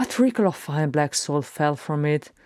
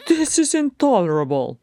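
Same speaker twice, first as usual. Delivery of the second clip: high, loud outraged courtier; spluttering; 8 semitones higher, 5 dB louder than usual. A narrator's reading. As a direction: burst out in high-pitched indignation. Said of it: "spluttered the brandy-soaked courtier".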